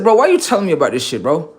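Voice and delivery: high, loud, fast complaint; loud fed-up disbelief; exasperated. Retold or imitated. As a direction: whine at a letdown. Annoyed at boring news.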